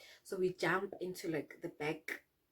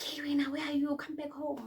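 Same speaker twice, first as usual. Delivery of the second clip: mom shouting; 7 semitones higher, 3 dB louder than usual. Retold or imitated.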